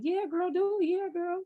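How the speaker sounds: high, excited mock cheer from her friends; mimicking hyped friends. Friends hyping her up about the podcast, high mock cheer. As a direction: egg her on like an eager girlfriend. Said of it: "they would always just be like".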